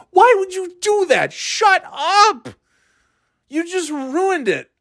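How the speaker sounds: very high-pitched scolding; exasperated outburst. Acted. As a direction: berate the heckler who spoiled it. Acted outburst at the heckler.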